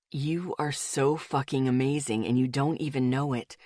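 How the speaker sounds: hushed and moved. A narrator's reading. Male friend, intense praise.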